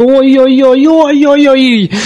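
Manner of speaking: rising, drawn-out alarmed oh-oh-oh; excited hockey goal call. Real, not acted. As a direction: cry out as the puck flies in. Goal chance, commentator exclaims. (взволнованный возглас комментатора)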